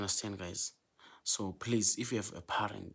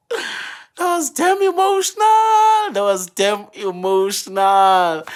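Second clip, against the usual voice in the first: much higher, much louder, slow, voice cracking with real emotion; overcome with emotion; 11 semitones higher, 16 dB louder, slower than usual. Real, not acted. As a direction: let the feeling break through, slow. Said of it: "I'm still emotional".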